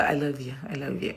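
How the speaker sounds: very quiet, low murmured sign-off; hushed little declaration of love; soft, affectionate. Real, not acted. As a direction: say it tenderly, almost to yourself.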